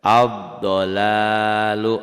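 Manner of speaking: Ad-dolalu chanted slowly and loudly, doubled d; recited Arabic example. Shown as a demonstration. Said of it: "tuh nggak dibaca". (Ad-dolalu dibaca pelan, lam tidak dibaca)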